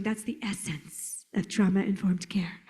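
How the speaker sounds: slow, hushed whisper; Doctor Purvis. Retold or imitated. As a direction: whisper it slowly and reverently. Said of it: "I can just hear Doctor Purvis going".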